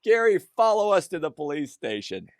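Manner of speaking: loud, high, laughing mock cop; mocking cop laughter. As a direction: ham up the deputies cracking up. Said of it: "they all go".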